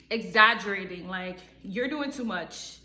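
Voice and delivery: usual speaking voice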